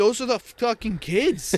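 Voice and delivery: high, loud disbelieving exclamation; we couldn't believe who we were playing; incredulous outburst. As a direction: blurt it out in shock. Team spots the opposing players.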